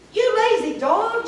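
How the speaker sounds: high, loud witch shout. A narrator's reading. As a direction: bellow at the lazy dog. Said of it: "shouted Bony Legs".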